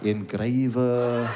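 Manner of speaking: very slow, low drawl; reading off the system. Retold or imitated. Call centre agent scans occupation list, slow and low. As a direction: drag out each syllable while searching. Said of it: "let me find it on the system".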